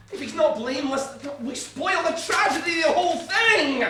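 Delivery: high frustrated shout. Real, not acted. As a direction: cry out in despair. Frustrated outburst about the story.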